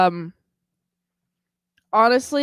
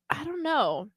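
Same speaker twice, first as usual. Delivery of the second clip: whisper; 6 semitones higher, 6 dB quieter than usual. Real, not acted. Sudden aside mid-thought.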